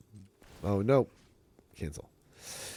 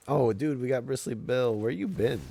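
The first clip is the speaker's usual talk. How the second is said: excited greeting; 8 semitones higher, 5 dB louder than usual. Real, not acted.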